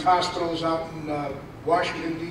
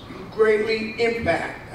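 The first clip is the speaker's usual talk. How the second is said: "greatly impact" slowed and leaned on, pitch lifted; emphasis on greatly impact; 4 semitones higher, slower than usual. Shown as a demonstration. Reading a citation aloud, leaning on "greatly impact". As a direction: stretch out "greatly impact" to press the point home. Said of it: "I put the emphasis on that".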